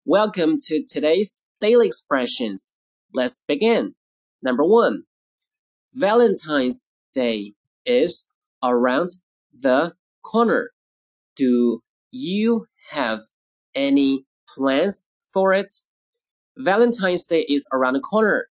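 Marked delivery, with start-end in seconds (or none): 5.9-15.7 very slow, a word or two at a time; slow model reading. Shown as a demonstration. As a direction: read it out slowly for learners, with pauses. Teacher models sentence word by word.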